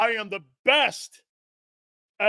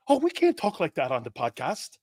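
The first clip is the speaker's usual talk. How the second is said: ordinary delivery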